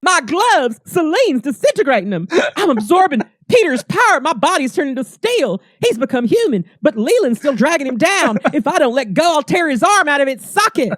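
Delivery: high, wailing melodrama; Rogue. Acted. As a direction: narrate your own peril in mock distress. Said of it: "Rogue's like".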